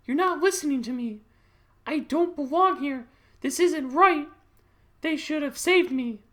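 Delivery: high, loud, drawn-out pleading; Sarah. Acted. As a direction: plead desperately in a put-on high voice. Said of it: "I'd have Sarah be like".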